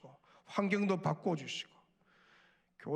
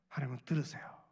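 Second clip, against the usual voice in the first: ordinary talk, nothing marked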